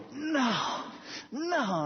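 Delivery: high, fussy denial; frustrated patient. Retold or imitated. Patient rejecting the answer, high and frustrated. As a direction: shake it off impatiently.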